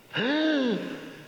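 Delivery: sharp, high, loud theatrical gasp; loud gasping in-breath. Shown as a demonstration. Teacher demonstrates a gasp for the audience, loud and high. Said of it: "take a really deep, audible, silly sound effect in-breath".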